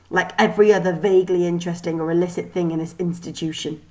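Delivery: ordinary delivery, nothing marked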